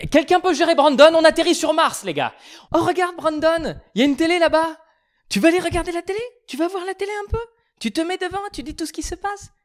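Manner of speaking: put-on high, coaxing voice to a child; talking to a child. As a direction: coax him like a kid.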